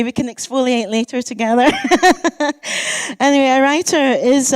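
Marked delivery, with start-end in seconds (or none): 1.8-3.2 high bright burst of laughter; real laugh. Real, not acted. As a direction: laugh along with the room. Laughing with the audience.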